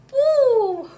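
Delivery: loud, high jeering boo. Real, not acted. Ranting viewer boos the movie loudly. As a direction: boo it like a crowd.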